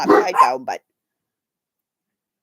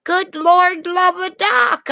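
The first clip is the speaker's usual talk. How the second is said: exasperated exclamation; 13 semitones higher, 4 dB louder, slower than usual. Real, not acted.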